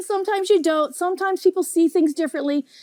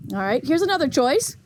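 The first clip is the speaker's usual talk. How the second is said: usual speaking voice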